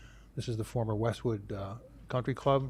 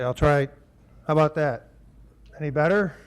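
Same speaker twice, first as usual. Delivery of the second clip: louder, testing the mic; talking louder; 3 semitones higher, 8 dB louder than usual. Real, not acted. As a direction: project your voice to the room. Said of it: "Want me to talk louder?".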